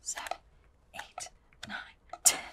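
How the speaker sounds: counting under the breath in a whisper. Real, not acted. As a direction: whisper the numbers to yourself.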